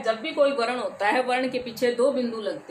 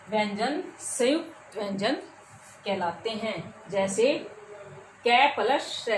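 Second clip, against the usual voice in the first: usual speaking voice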